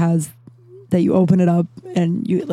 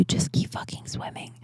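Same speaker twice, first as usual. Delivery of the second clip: fierce whisper to herself; hushed whispered mantra to herself; self-pep whisper; 13 dB quieter than usual. Shown as a demonstration. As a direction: whisper it as a private pep talk. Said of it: "I needed to hear that for myself".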